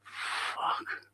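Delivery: whispered curse, barely voiced; under-breath swearing. Real, not acted. Streamer curses to himself as something goes wrong, whispered. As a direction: swear softly to yourself in a whisper.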